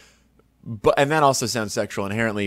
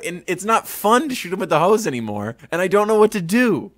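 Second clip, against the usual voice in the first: ordinary talk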